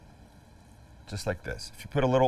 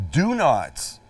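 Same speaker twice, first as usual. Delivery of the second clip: "do not" pitched higher, with firm emphasis; emphatic stress; 5 semitones higher, 4 dB louder than usual. Real, not acted. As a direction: hit "do not" hard as a warning. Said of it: "I cannot stress this enough".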